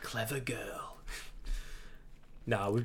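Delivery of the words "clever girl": Jurassic Park quote. Pretend. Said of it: "best impressions of the, of the dinosaurs".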